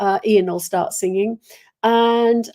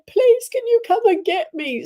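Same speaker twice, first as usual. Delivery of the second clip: pleading; 11 semitones higher, 4 dB louder than usual. Retold or imitated. Exhausted traveller phoning her mum.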